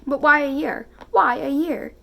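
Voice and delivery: distressed protest. A narrator's reading. Natasha protests the year's delay to Andre, loud and pleading. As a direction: Natasha begs to know the reason, pushing it out loud.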